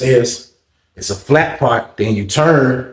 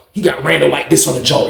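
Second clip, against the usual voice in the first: ordinary talk, nothing marked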